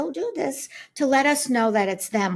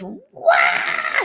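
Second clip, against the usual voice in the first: a sudden, much higher, loud bird squawk; imitated bird scream; 7 dB louder than usual. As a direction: screech it out like the angry little bird. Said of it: "there's a bird screaming".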